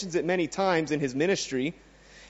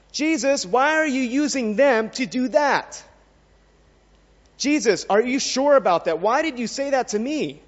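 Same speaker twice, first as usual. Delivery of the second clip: higher-pitched, mock-incredulous challenge; raised, emphatic disbelieving questions; questioning Jesus; 6 semitones higher, 4 dB louder than usual. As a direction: voice a doubter pleading for an explanation. Voicing believers questioning Jesus' ways.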